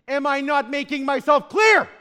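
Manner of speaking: loud, high mock shout; frustrated shout. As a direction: demand it like you've had enough. Mimicking frustrated person.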